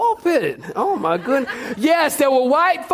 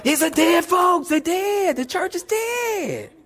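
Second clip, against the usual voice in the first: shocked churchgoer; 5 semitones higher, slower than usual. Retold or imitated. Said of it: "And I'm like".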